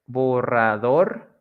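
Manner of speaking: "borrador" slowly split as bo-rra-DOR, rising; stress on last syllable. Shown as a demonstration. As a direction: draw out "borrador" up to a lifted dor. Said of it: "like in the examples".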